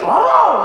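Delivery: sudden high loud cry of pain; pained yelp. Retold or imitated. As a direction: yelp as the cane hits the shin. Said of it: "Dia teriak".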